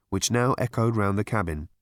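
ordinary delivery

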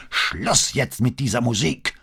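furious roar at the blaring music. Acted. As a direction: bellow it down the stairs. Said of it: "brüllte er wieder".

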